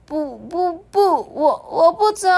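high, straining stammered refusal; high strained stammer; struggling. Acted. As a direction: push out each word under the weight. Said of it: "他挣扎地说".

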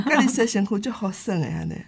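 laughing through a retold line; chuckling while quoting grandma.

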